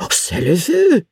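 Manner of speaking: high, alarmed French; a servant blurting out in French. A narrator's reading. Isidore alarmed at the cannon, in French.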